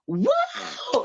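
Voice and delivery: very high, drawn-out amazed wow; awed wow. Retold or imitated. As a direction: let a crowd's amazement out in one word. Whole crew gasps at her.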